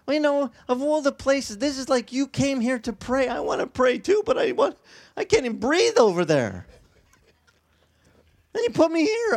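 high, loud pleading to God; desperate prayer. Retold or imitated. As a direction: cry out to the Lord, half laughing at yourself. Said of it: "I was like".

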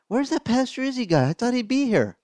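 high puzzled voice, searching for the pastor; puzzled looking around. Retold or imitated. Congregant in heaven looking for the pastor: high, puzzled. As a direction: play someone bewildered in heaven, pitched high. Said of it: "You'd be like".

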